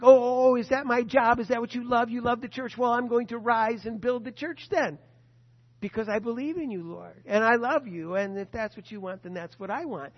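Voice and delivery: loud, rising excited "oh, oh, oh" then earnest promise; a believer suddenly getting it, all in; eager believer's reply. Retold or imitated. Believer answering the Lord, eager and loud.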